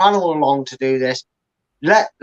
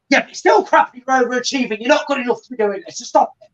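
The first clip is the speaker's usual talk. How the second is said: scornful manager imitation; 7 semitones higher than usual. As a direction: sneer like a manager crushing his team's pride. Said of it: "he turns around and goes".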